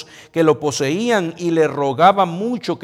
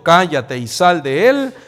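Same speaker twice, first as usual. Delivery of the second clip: louder, slow command; stern rebuke; 8 dB louder than usual. Acted. Jesus rebuking the unclean spirit, loud and slow. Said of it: "le reprendió diciendo".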